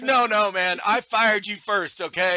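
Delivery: mock defiance. Listener acting out Riley's comeback to the field, mock defiant. Acted firing quarrel.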